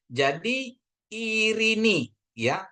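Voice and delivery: Irini drawn out high and slow, the ei as one I sound; slow demo reading. Shown as a demonstration. Teacher models Irini slowly for students. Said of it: "tapi dia bacanya satu".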